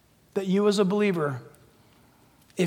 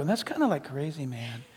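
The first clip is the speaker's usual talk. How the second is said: dismissive; 6 semitones lower, 10 dB quieter than usual. Retold or imitated. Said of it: "they go".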